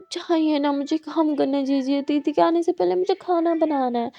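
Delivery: usual speaking voice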